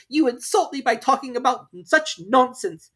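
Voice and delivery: high, loud huffy; offended mouse. Acted. Mouse walking off, high and offended. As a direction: huff and turn away.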